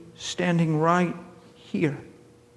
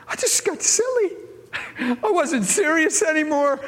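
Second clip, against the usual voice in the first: higher, giggly and silly; 9 semitones higher than usual. Retold or imitated. Preacher retelling his giggle, higher and silly. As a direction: let the silliness bubble up. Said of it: "I said".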